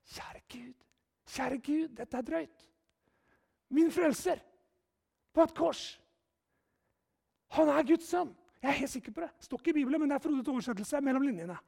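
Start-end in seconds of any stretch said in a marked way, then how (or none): 0.1-8.3 awed whisper. Retold or imitated. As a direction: pray it under your breath in awe. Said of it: "han sto der og tenkte". (hviskende, ærefryktig bønn)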